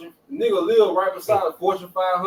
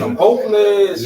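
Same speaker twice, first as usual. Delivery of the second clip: drawn-out, louder impassioned cry; 4 semitones higher, 7 dB louder than usual. Retold or imitated. Imitating the rapper to make a point about hopelessness, passionately. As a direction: say it slow and loud, full of passion. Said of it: "he said it with, uh, passion".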